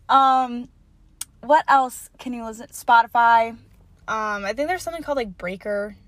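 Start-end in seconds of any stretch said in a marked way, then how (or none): none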